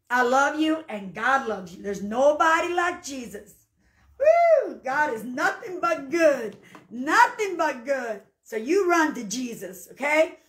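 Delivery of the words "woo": high, loud woo; sudden high church holler; praise whoop. Real, not acted. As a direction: let out a real whoop of worship. Praise at close of testimony.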